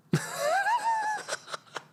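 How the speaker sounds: helpless high-pitched laughing fit. Real, not acted. Streamer failing to get into character, laughing. Cracking up over doing Trump.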